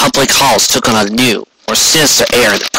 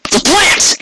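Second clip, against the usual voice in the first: a sharp, much higher exclamation; sudden realisation. Acted. Rainbow realising the plant's secret, excited gasp. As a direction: blurt it as it clicks.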